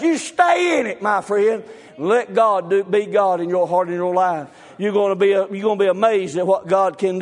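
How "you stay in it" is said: high, ringing shout on stay; preaching shout. Real, not acted. Preacher to the discouraged believer, a soaring shout. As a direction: throw it out over the congregation at full force.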